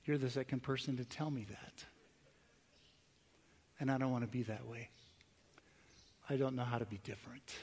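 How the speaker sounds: retold low, subdued confession; quietly imitating a man humbled near tears; tearful admission. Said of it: "his eyes filled with tears".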